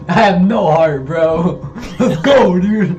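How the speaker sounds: loud, high hyped-up imitation; excited. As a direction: mimic him getting all excited. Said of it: "You started getting all excited".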